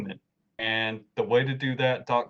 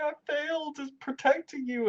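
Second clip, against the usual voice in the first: very dramatic. Retold or imitated. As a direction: wail it high like a tragic hero, hammed up. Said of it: "It's very dramatic".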